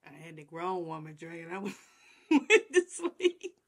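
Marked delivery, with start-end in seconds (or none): 1.2-3.5 laughing. Real, not acted. Laughs through the line.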